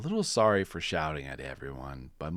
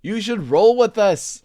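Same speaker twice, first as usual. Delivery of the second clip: much higher and louder hooting; excited monkeys; 13 dB louder than usual. Acted. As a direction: bounce it like playful monkeys. Zebras voiced.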